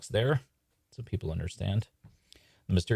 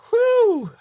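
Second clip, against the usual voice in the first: very high, loud whoop of relief; relieved whew; 12 dB louder than usual. Acted. Magician spared from the duel, relieved whew. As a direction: let out a huge theatrical whew.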